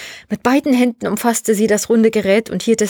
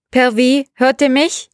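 higher questioning radio call; 4 semitones higher, 4 dB louder than usual. Acted. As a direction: call out to check who hears you. Sergei calls over the radio.

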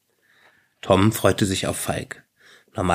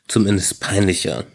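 ordinary delivery, nothing marked

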